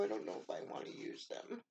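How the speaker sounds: playful conspiratorial whisper; whispered aside. Real, not acted. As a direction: confide it under your breath. Aside about hoarding the gifts.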